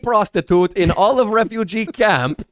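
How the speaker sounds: put-on Borat accent. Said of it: "It's a Borat joke".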